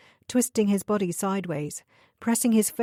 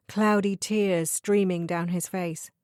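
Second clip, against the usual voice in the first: plain reading voice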